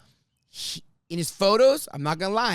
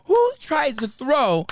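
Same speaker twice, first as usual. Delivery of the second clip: higher, louder and slow, drawn out; stretched-out disbelief; 5 semitones higher, 4 dB louder, much slower than usual. Retold or imitated. Husband imitated mocking her stunt, slow, higher and louder. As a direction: spell out the absurdity slowly. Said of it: "He's like".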